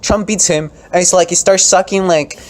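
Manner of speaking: high, loud mocking rant; worked-up contemptuous rant; heated rant. Real, not acted. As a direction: vent scornfully about the senator. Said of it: "ranting about Ted Cruz".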